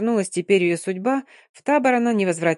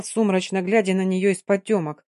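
ordinary delivery, nothing marked

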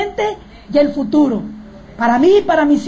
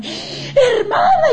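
a much higher, stretched tearful cry; 13 semitones higher, much slower than usual. Retold or imitated. Friend cries out to the sister, remembering the stove, tearful and high. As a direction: wail the word out, half crying.

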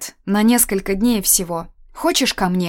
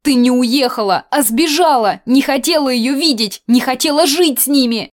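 громкий высокий упрёк; брось обвинение в лицо; обвиняя; 6 semitones higher, 6 dB louder than usual. A narrator's reading. Аня обвиняет Олю. (гневный упрёк)

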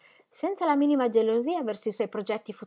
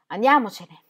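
impatient; 7 dB louder than usual. Acted. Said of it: "disse Ingram con un moto di impazienza".

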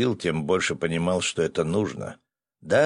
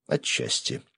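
plain reading voice

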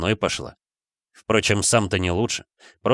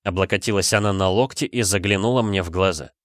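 plain reading voice

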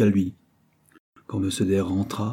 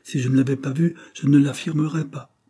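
higher, insistent retort; standing her ground; 7 semitones higher, 4 dB louder than usual. A narrator's reading. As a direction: defend your word in a raised pitch. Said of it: "qui aimait fort, comme sa mère, à soutenir son opinion".

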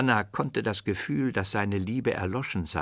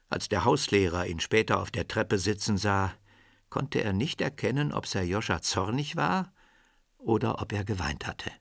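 normal talking voice

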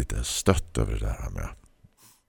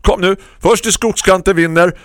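much higher and louder call to race; shouting; 13 dB louder than usual. A narrator's reading. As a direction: yell it while setting off running. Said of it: "skrek hon". (skrikande, mycket högre och starkare)

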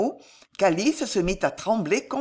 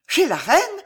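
high, louder, alarmed question; trembling cry; 10 semitones higher, 5 dB louder than usual. A narrator's reading. Alice learns her fiancé went to the queen, trembling cry. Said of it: "s'écria Alice frémissante".

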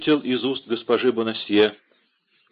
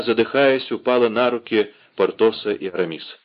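ordinary delivery, nothing marked

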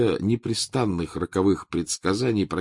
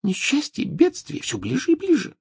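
Trelawney voice. Acted.

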